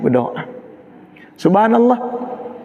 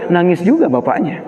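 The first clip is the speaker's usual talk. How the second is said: ordinary delivery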